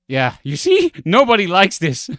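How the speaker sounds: real triumphant shout with a laugh; loud vindication. Real, not acted. Streamer seeing that others hate the dish too, loud laughing vindication. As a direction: crow it loudly and high, breaking into laughter.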